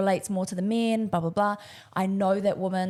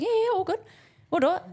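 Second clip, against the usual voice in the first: much higher, easy reassurance; breezily confident; 12 semitones higher, faster than usual. Retold or imitated. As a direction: act out cocky willingness, pitched way up. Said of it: "Because I was just so confident".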